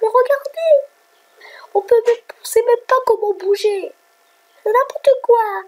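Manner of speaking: very high little complaining voice; high-pitched mock-sweet grumble; put-on little voice. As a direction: pipe up in a tiny high voice. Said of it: "la petite voix douce pour vous dire que c'est con".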